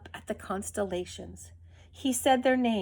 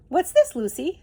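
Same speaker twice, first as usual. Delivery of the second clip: curious gentle giant, louder and higher; big bearded man; 5 semitones higher, 5 dB louder than usual. Acted. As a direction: ask the dog, intrigued. Big man voiced by narrator.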